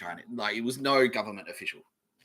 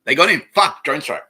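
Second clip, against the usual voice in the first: louder, higher, hammed-up alarm; mock alarmed shout; 7 semitones higher, 10 dB louder than usual. Imagined agents react as streamer ends stream, mock alarmed shout. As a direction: play an agent crowing over a hit. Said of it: "And it's like".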